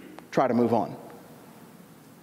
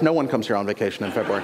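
much lower, fast imitation of a dismissive local; standoffish islander; 12 semitones lower, faster than usual. Islander's buddy to vacationer, mock standoffish. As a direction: drop low and dismiss the visitor quickly. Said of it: "they were very territorial and standoffish".

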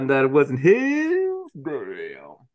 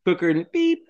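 ordinary talk

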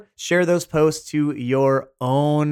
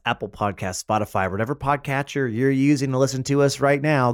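ordinary delivery

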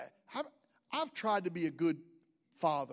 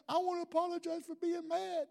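higher, put-on woman's voice; mock lady voice; 9 semitones higher, 3 dB quieter than usual. Jealous lady apologizing to Sherry, higher mock voice.

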